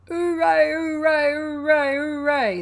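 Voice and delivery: high, loud drawn-out hoorays; full-voiced celebratory chant; cheering crowd. A narrator's reading.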